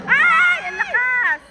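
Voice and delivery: high, loud yelp easing down; excited shout. Real, not acted. Spectator amazed by a strong play, excited shout. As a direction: cry out at a powerful play.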